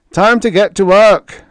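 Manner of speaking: booming wake-up call, loud; loud rumble. A narrator's reading. Sailor rousing the dazed stowaway, loud rumble. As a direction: bellow him awake. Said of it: "the voice rumbled loudly".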